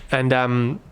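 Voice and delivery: usual speaking voice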